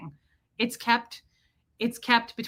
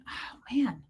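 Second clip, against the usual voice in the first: retold whisper; 7 dB quieter than usual. Retold reply to dead relatives.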